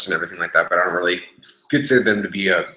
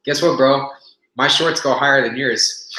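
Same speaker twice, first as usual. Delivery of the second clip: higher, loud teasing, slowing at the end; troll him with a smug brag; trolling the gym guy about his shorts, smug and teasing; mocking taunt; 4 semitones higher, 3 dB louder, slower than usual. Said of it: "I'm like".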